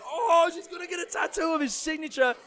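high-pitched mock crowd frenzy; crowd losing it. Podcaster mimicking the concert crowd over a tattoo signature, losing it. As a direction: squeal it like a whole audience going wild. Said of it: "the whole crowd are losing their shit".

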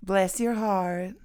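slow, put-on Southern sweetness; Southern accent. Saying goodbye to followers in a slow Southern accent. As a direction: drawl it like a church lady. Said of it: "let's do it in a country accent".

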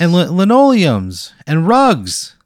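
high, loud, nervous local ad voice; nervous TV pitchman. Acted. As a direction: play an awkward local TV salesman. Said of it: "He goes".

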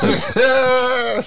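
high laugh breaking the words. Real, not acted. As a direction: laugh through it. Laughing while speaking.

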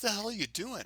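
mock barked challenge from the sergeant; gruff sergeant. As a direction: sergeant catching a stunned rookie, mock demand. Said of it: "a sergeant comes up to me and says".

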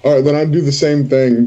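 ordinary talk

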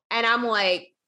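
ordinary talk, nothing marked

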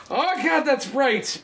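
high, startled exclamation; startled cry. Retold or imitated. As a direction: react as if suddenly dazzled. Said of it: "you went".

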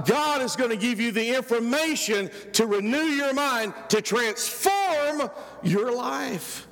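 high, ringing pulpit voice, stretching key words; fiery preacher in full flow; preaching at full force. Acted. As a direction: preach it out to the whole church.

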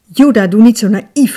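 loud brotherly scolding; exasperated call. A narrator's reading. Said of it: "riep zijn broer".

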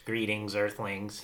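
put-on old speech synthesizer. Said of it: "So this is E T".

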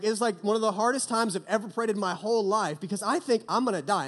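ordinary talk, nothing marked

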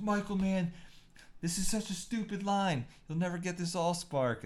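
high, exasperated actor griping to the director; mock a fed-up actor whining, then sneer at the line; Shia LaBeouf complaining. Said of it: "he's like".

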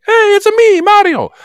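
very high, bouncy Italian cartoon accent; squeaky Italian plumber "it's a me"; classic Mario voice. Imitating the cartoon Mario's Italian accent for cohost. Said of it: "original Mario voice".

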